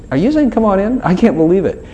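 high-pitched disbelieving question; incredulous. Acted. Sinner answering God's invitation, incredulous. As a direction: question it as if too good to be true.

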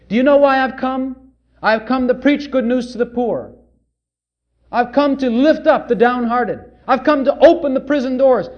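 fervent preached proclamation of Jesus; preaching Jesus's words. Acted. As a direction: thunder it out as Jesus declaring his mission.

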